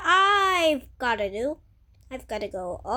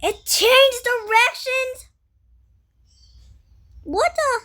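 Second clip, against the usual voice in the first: high startled cry of disbelief; surprised shout; 10 semitones higher, 7 dB louder than usual. Real, not acted. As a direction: yelp it out in shock. Tornado suddenly changes course.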